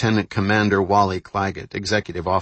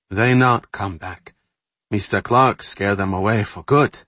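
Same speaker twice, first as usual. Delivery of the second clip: put-on accented English; 3 dB louder than usual. Carol's broken English.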